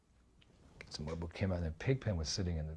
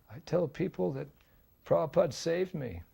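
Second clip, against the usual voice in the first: Pigpen's voice; 8 semitones higher than usual. Retold or imitated. Pigpen quoted.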